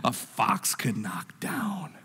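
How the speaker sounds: slow, drawn-out taunt fading to a whisper; Sanballat. Acted. Preacher as Sanballat mocking the builders, slow breathy taunt. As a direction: stretch it out, belittling their work. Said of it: "Is it more like Sanballat's".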